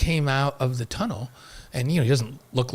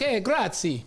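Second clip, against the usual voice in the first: higher, hammed-up Italian greeting; playful Italian; 6 semitones higher, much slower than usual. Host joking about the art show, playful Italian. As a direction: play at being Italian. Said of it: "we'll speak Italian".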